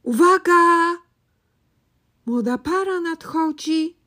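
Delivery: loud, high call of alarm; loud warning. Acted. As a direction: shout the warning across the room. Calling out a warning.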